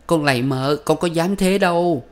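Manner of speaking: high, weeping plea to the mistress; tearful pleading. A narrator's reading. Servant girl begging her mistress, tearful and high. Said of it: "Con Sen khóc lóc".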